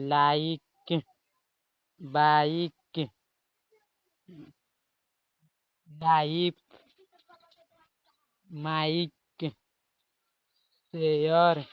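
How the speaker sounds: लाइक, बाइक, लाइफ each spoken slowly and loud; slow, emphatic listing of लाइक बाइक लाइफ माइक; word list read out. Shown as a demonstration. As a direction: read the example words one by one, deliberately. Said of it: "जिसमें देखिए".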